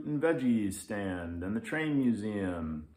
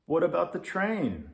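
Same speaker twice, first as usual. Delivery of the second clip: put-on much higher, bright child's question; eager kid; 9 semitones higher, 6 dB louder than usual. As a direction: pipe up hopefully.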